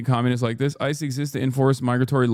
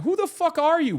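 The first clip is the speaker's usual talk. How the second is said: much higher, sharp outraged question; furious incredulity; 13 semitones higher than usual. Real, not acted. To chat, raging at the critic, high outraged yell.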